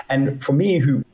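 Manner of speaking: ordinary delivery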